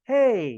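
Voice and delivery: loud "हे" launched high and sliding down; high-to-low intonation. Shown as a demonstration. Teacher demonstrating intonation to students, "हे" gliding high to low. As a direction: start "हे" way up, drop it low. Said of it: "high pitch से जाता है वो low pitch पर".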